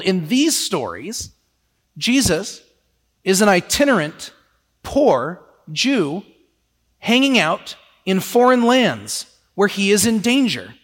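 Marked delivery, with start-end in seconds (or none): none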